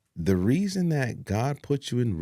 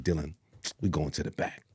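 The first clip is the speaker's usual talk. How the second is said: quiet confidence; 6 semitones lower, 7 dB quieter, faster than usual. Retold or imitated. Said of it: "real confident".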